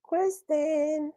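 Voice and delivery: high drawn-out call. Real, not acted. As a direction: stretch the name to call her. Host calls absent guest again.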